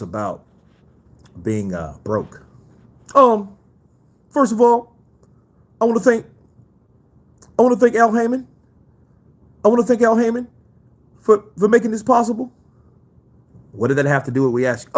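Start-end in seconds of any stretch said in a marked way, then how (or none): 3.1-12.5 higher, louder boastful boxer voice; a higher-pitched Mayweather press statement; Floyd Mayweather impression. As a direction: address the rumors like a cocky champ. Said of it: "[name], do Floyd".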